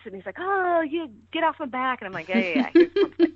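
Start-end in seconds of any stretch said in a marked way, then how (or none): 0.4-2.0 mock-annoyed brother, pitched higher; grumpy brother. Brother voiced, grumbling at her. 2.3-3.3 higher and louder mock-exasperated yeah yeah; teasing dismissal. Her teasing reply to brother.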